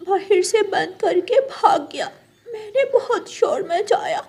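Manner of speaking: put-on high child voice telling the story through tears; a little bird sobbing out what happened; tearful child. Baby bird tells his mother how Jaggu locked him in, high tearful child voice.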